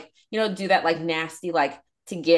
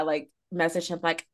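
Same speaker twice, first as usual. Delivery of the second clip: ordinary talk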